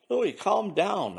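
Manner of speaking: slow, soothing reassurance; calming. Acted. Brando calming his panicked friend, slow and soothing. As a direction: settle him down gently.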